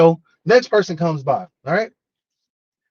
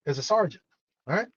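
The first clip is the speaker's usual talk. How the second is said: ordinary talk, nothing marked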